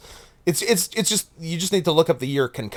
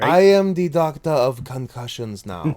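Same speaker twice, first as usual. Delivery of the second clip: hammed-up African doctor accent; Will Smith's bad accent; 4 dB louder than usual. Co-host parodying the Concussion doctor, mock African accent. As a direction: declare it in a poorly faked African accent. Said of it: "as strong as Will Smith was attempting to do poorly".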